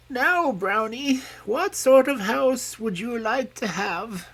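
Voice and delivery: put-on high, gentle voice of an old mother pig; mother pig telling her piglets she is growing old. A narrator's reading. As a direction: play the frail old sow advising her children.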